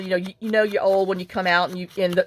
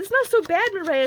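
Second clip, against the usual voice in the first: consoling a friend in a much higher, gentle voice; high comforting reassurance; 13 semitones higher than usual. Retold or imitated. Consoling friend after her failed parking-lot dance, high reassuring tone. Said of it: "I said".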